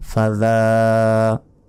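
ফাজালিকাল slow, with the ذ made by the tongue and the long a held; careful tajweed ফাজালিকাল; correct pronunciation. Shown as a demonstration. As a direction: sound out ফাজালিকাল slowly. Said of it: "বলতে হবে".

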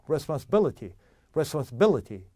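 responsibility with BIL strong, the rest skimmed; responsibility rattled through around a stressed BIL; stress only BIL. Shown as a demonstration. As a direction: practice responsibility, hitting just the BIL. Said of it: "B만 강하게".

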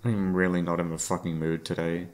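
ordinary delivery

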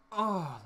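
big exaggerated huffing grunt; loud strained "oh" like a heavy sigh. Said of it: "and they go".

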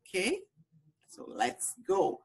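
ordinary delivery

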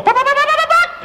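very high squeaky chicken pops; chicken clucking. Retold or imitated. Mimicking the radio chicken for the host, very high squeaks. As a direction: squeak out the pops like a hen laying. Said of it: "the chicken would go".

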